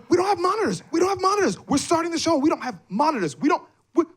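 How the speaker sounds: high-pitched frantic retold panic; frantic backstage alert; panicked alarm. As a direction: blurt it out in a comic panic, voice way up. Retold panicked run to stage manager.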